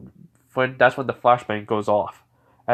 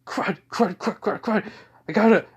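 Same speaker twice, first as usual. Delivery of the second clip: high, rapid-fire fretting; panicked muttering; 8 semitones higher than usual. Acted. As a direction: repeat it in a high, urgent fluster. Bakugo's panicked thought while falling.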